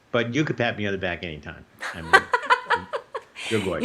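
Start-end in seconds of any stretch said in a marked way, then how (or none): 2.2-3.4 sudden high laugh; embarrassed high laugh at the compliment; high burst of laughter. Real, not acted. Host praises him, he laughs.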